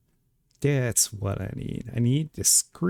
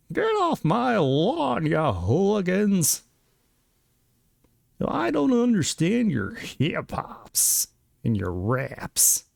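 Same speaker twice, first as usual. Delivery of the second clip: put-on slow, high cranky old geezer; grumpy old man; 9 semitones higher, slower than usual. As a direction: shake your fist, slow and whiny. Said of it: "This old man on his soapbox, shaking his fist".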